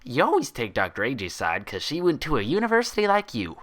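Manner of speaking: high, sulky pout of a bubbly pony; high pouty pony voice; pouting Pinkie Pie. A narrator's reading. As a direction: whine it like a pouting kid. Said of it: "Pinkie Pie pouted".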